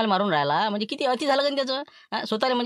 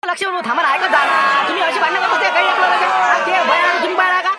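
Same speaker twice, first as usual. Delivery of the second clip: high, loud shouting over the brawl; chaotic yelling; 10 semitones higher, 8 dB louder than usual. Acted. Villagers shouting while pulling the fighters apart, frantic yelling. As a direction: yell to break up the fight.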